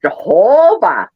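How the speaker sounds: loud, slow Midwestern Jehovah; exaggerated Wisconsin Jehovah; Midwest accent. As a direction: drawl Jehovah, long O, Upper Midwest. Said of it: "Michigander accent where it's like".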